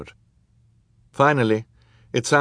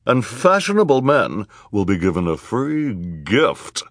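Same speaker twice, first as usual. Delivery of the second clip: snarled, showy character voice, pitched up; 4 semitones higher, 4 dB louder than usual. A narrator's reading. Socialite outdoing the villain's party invitation, snarling. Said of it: "snarled the voice of Esme Squalor".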